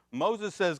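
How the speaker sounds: ordinary talk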